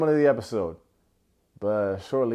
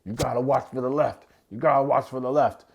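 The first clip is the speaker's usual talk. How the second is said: corner coach; 2 semitones higher than usual. Retold or imitated. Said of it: "they're like".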